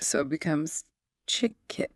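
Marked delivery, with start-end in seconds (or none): 1.3-1.9 "chicket" with the K carried onto "it"; linked consonant. Shown as a demonstration. Co-host trying out how check links to it, linked consonant. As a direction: run "chicket" together as one quiet word. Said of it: "the K in check moves over to it".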